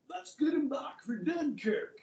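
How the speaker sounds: a rough attempt at a British accent; rally the troops in a shaky British accent. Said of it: "my British accent's not very good".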